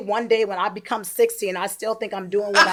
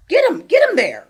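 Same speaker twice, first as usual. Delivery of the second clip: higher, louder, punchy push; rousing urging; 9 semitones higher, 6 dB louder than usual. Acted. Teacher to students who are not alert, rousing call. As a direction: urge them sharply to attention.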